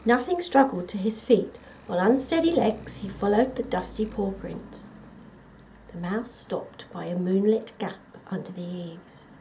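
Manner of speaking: plain reading voice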